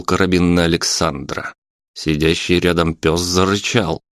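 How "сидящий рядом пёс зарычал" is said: ordinary delivery, nothing marked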